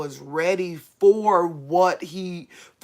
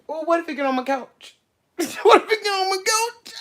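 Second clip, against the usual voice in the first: high, fast, frantic laugh; panicked laughing; 12 semitones higher, faster than usual. Real, not acted.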